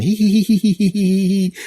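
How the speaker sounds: high, tittering giggle; a high mischievous little laugh; nephew giggling. Retold or imitated. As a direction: giggle like a cheeky kid. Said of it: "then he's laughing".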